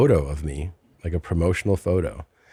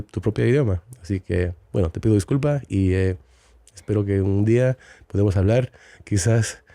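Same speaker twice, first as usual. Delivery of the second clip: formal, lightly theatrical apology in Spanish; mock apology in Spanish. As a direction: address Hilaria like a heartfelt public apology. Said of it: "fun watching you talk Mexican".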